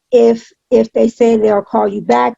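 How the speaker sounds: normal talking voice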